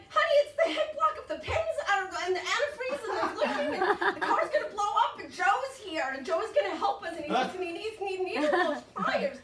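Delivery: high, breathless-sounding panicked rush; hammed-up panic, pitched high; frantic babbling. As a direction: babble hysterically to a spouse. Panicked phone call to husband.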